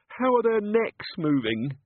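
high, slow trembling question; quavering. Acted. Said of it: "quavered Chickenwire".

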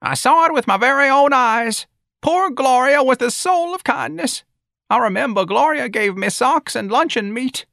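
put-on very high, loud piping old-lady voice; insistent old woman. A narrator's reading.

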